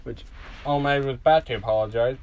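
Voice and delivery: usual speaking voice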